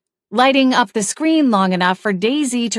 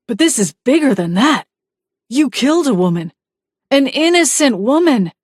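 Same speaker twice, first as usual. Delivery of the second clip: louder, higher, climbing to a peak on "innocent"; angry accusation; 5 semitones higher, 4 dB louder than usual. Acted. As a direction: confront the killer, building in force. Husband accusing wife of murder.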